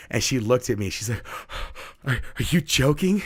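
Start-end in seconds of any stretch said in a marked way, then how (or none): 1.3-2.0 short airy mock gasp; imitated shocked gasp. Student learns it's a joke, imitated gasp. 2.1-3.2 higher, stammered mock shock; imitated disbelief. Student asks teacher after fake bad news, imitated disbelief. Student realizes it's a prank.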